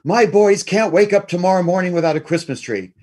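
mock-frantic motherly protest; frantic mom. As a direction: voice an overwrought mother digging in. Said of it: "she just goes crazy".

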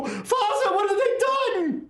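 put-on high, accented horrified cry; accented woman's wail. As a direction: lament over the fallen father. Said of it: "you hear a voice behind you go".

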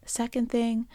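normal talking voice

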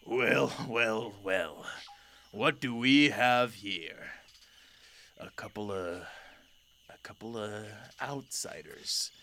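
lightly voiced old lion, slow and drawn out; old lion ruler. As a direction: greet intruders as an aged lion king, with long pauses. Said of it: "He's very old".

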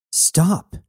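urgent plea. Acted. Jazz grabbing Knox to halt him.

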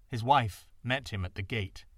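usual speaking voice

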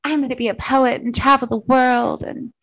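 put-on high, starry-eyed teen; a naive teenager dreaming aloud; dreamy teen voice. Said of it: "I was like".

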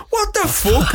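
shrill disbelieving shriek up high; stunned high yelp. Retold or imitated. Lads hearing their film just won, shocked high squeal. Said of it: "everyone turns around and goes".

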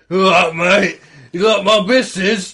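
lightly voiced lad showing off his tattoo; shirtless English fan. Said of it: "he's like".

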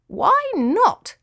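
high, plaintive small voice; mildly sad, high-pitched question; sad little cricket. A narrator's reading. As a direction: ask as a let-down little cricket. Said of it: "asked Charlie sadly".

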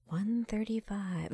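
soft, slow flat announcement; quiet deadpan. Pretend. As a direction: announce it softly and slowly. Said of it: "he'd be like".

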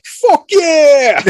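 very high, loud whoop; retold cheer. Kids watching the bully beaten, retold triumphant cheer. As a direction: shout it like a cheering kid.

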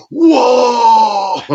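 loud high crowd whoa; crowd roar. Retold or imitated. Wrestler voicing the crowd's shock, loud whoa. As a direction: voice the stunned fans. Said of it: "so they're all like".

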